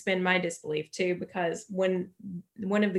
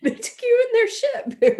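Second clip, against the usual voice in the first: mock distress; 5 dB louder than usual.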